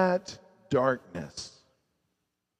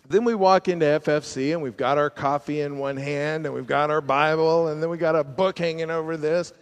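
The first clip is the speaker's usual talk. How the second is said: ordinary delivery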